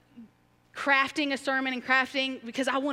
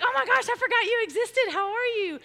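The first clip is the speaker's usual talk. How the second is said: much higher, louder, surprised gush; startled greeting; 12 semitones higher, 5 dB louder than usual. Retold or imitated. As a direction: blurt it out in sudden recognition. Greeting someone she forgot.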